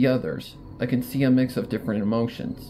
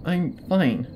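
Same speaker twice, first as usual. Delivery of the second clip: curt; 5 semitones higher than usual. Acted. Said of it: "my words coming out far more curt".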